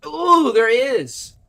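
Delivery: high, loud, drawn-out startled cry; loud, high-pitched burst of surprise; startled exclamation. Real, not acted. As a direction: yelp as you suddenly spot someone. Startled by somebody in the cave.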